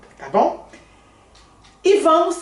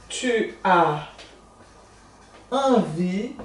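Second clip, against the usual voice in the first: 1 word by word; 3 dB quieter, much slower than usual. Shown as a demonstration. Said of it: "word by word dictation".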